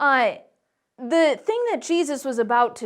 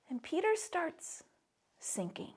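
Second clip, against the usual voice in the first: fading whisper; 3 semitones higher, 14 dB quieter than usual. A narrator's reading. Telling the children Peter goes under, fading to a whisper. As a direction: drop to a whisper on sinking. Peter sinking, dramatic drop.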